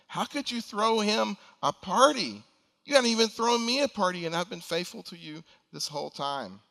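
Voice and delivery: a resentful son confronting his father; angry challenge. Acted. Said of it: "he challenges his father".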